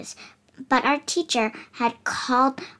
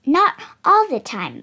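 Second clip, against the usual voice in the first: ordinary delivery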